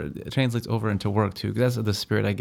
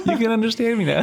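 mock accented voice; 11 semitones higher than usual. Mocking kids' accent talk.

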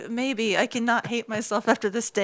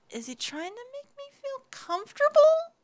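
confused; 14 semitones higher, 4 dB quieter than usual. Retold or imitated. Retold confused inner thought.

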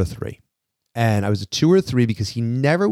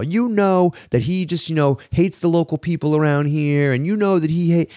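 belligerent stranger; 6 semitones higher than usual. Retold or imitated. Drunk stranger telling cousin about father-in-law, high mock accusation. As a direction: drag out the accusation like the agitated guy.

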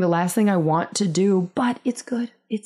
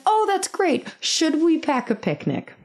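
high, perky mom voice; imitated eager mom; 9 semitones higher than usual. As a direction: chirp it like a keen playdate mom. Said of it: "And she's like".

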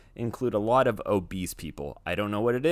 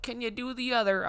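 much higher put-on voice; mimicking woman; 11 semitones higher, 3 dB quieter than usual. Retelling the woman asking him to do the other slipper, mildly imitated. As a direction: lightly mimic her asking again. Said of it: "She was like".

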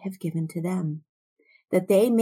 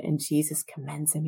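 ordinary talk, nothing marked

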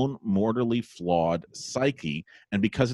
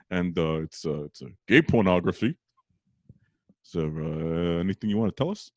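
lightly voiced Brooklyn interrogator, full of hesitation; Brooklyn detective dad; 3 semitones lower than usual. Brooklyn detective dad asks son to confess, lightly imitated, halting. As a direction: imitate an awkward Brooklyn father questioning. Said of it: "he talks like a detective from Brooklyn".

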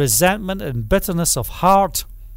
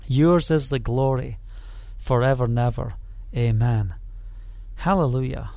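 fading lower and softer with each line; prayerful hush; 6 semitones lower, 4 dB quieter than usual. Acted. Preacher closing the prayer, fading into quiet reverence. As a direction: let the doxology sink to a reverent hush.

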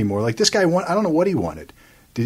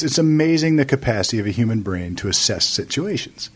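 ordinary talk, nothing marked

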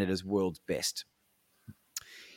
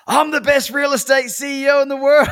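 much higher, loud triumphant yell; 9 dB louder than usual. Retold or imitated. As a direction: bellow it at the top of the lungs. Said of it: "yelling at the top of his lungs".

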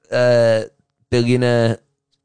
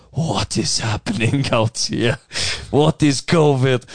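slow, lower, baffled voice of someone who never watches the news; clueless bystander; 3 semitones lower than usual. Pretend. Someone who skipped the news, seeing masks everywhere, slow baffled voice. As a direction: drawl it out, totally lost. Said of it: "and be like".

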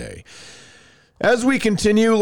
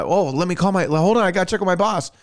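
quick, higher put-on stalling salesman voice; mock salesperson; 6 semitones higher, faster than usual. Car salesperson stalling buyers, hammed-up voice. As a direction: rush it like a salesman stalling you. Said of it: "the number of a salesperson".

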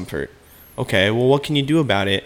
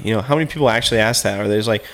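normal talking voice

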